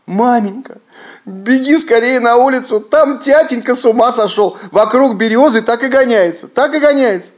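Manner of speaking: put-on high, loud scared boys' call; frightened crying children. A narrator's reading. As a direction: cry out to mama in fear. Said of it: "заплакали со страху, кричат".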